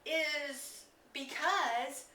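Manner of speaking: ordinary talk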